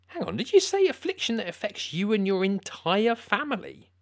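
surprised. Acted. Host suddenly catches the word.

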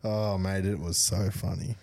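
ordinary delivery

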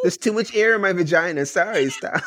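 mock girly voice. Mock girlfriend voice.

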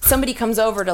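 ordinary delivery

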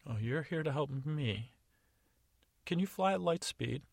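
a lightly voiced fairy testing him; Tooth Fairy. Fairy quizzing the gnome, lightly doubtful. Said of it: "And she said".

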